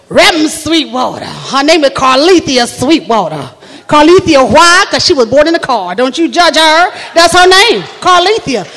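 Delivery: Southern church lady prophesying; stretched vowels, emphatic swoops; Carlethia Sweetwater. Acted. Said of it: "That's what she do".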